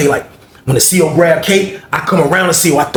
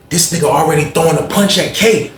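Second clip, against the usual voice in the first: usual speaking voice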